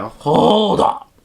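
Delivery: drawn-out, emphatic objection; retold objection. Student retelling reply to police accusation, emphatic objection. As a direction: stop them short, retelling your own disbelief.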